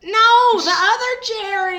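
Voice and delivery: high loud correction; loud exasperated. Real, not acted. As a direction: cry out No, the other one. Correcting the confusion loudly.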